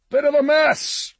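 high shouted remark across a distance; calling out. A narrator's reading. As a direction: shout it out to someone far away. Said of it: "he called out".

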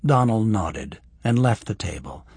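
usual speaking voice

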